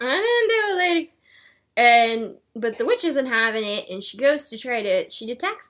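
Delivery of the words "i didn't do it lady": a laughing innocent protest; playful high denial; mock denial. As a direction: insist on innocence, giggling. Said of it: "And Boggy's like".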